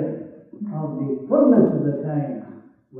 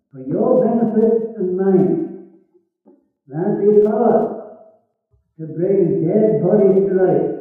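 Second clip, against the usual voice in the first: higher, louder sung-out preaching; fervent preaching; 3 semitones higher, 5 dB louder than usual. Acted. Preacher stirring the congregation about resurrection power, fervent and sung-out. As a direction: lift the congregation with rising fervour.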